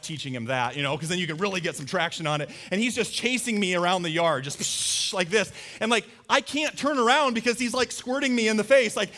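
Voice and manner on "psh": hissed "psh" of spraying water; imitated squirt noise. Showing the chase around the yard, spray noise. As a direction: mimic a squirt gun jet.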